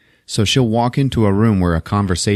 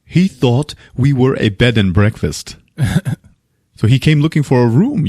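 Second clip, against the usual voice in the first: explaining host; 3 semitones higher than usual. Retold or imitated. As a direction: spell it out in a hammed-up imitation. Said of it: "And he's like".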